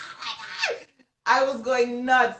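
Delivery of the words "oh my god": very high squeal of delight; excited scream. Retold or imitated. Opening the first printed copy of her book, delighted squeal. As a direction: squeal it out as you see the colors. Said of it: "I started screaming again".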